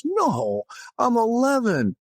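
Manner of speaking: put-on huffy kid correcting the priest; indignant kid.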